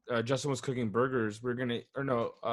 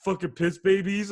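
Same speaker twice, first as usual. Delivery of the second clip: mock rowdy taunt; 5 semitones higher, 4 dB louder than usual. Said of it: "he's like".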